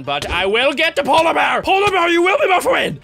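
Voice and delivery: put-on loud French accent. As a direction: shout it like a Frenchman. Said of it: "I'm talking like a Frenchman".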